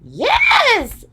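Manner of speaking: high excited shout of yes; a floppy straw man bursting with glee; excited Scarecrow. A narrator's reading. Scarecrow cheering the plan, high and loud. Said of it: "said the Scarecrow".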